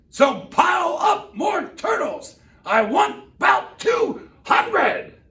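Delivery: high, loud, slow demanding order; royal command. Acted. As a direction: command it, drawn out and greedy. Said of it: "his royal voice thundered".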